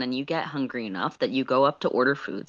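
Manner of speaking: usual speaking voice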